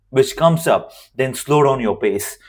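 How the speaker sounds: usual speaking voice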